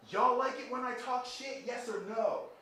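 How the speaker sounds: high, loud, drawn-out shout to an arena. Retold or imitated. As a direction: holler it out like a rapper working the crowd. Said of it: "he looked at the audience and he said".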